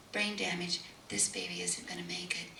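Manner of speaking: dropped to a near whisper; grim hushed verdict. Retold or imitated. Retelling the doctors' verdict, hushed whisper. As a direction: breathe the doctors' bad news.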